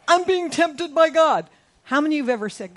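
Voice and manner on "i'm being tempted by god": high, loud whiny complaint; a hint of a self-pitying whiner; whining complainer. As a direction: whine that God tempts you. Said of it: "should say".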